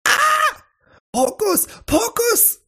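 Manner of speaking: shrieking very high, stretched out; a startled shriek, very high and slow; high scream. A narrator's reading. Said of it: "und schreit".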